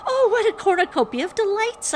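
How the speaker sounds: very high, loud squeal; gushing high-pitched thrill; delighted squeal. A narrator's reading. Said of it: "I squealed".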